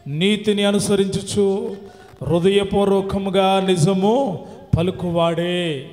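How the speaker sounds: loud, drawn-out recitation of a psalm; scripture reading. Acted. Preacher reads the psalm to listeners, loud solemn recitation. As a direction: declaim the verse slowly at full voice.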